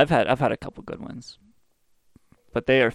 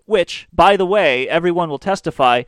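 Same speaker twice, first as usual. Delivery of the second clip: ordinary talk, nothing marked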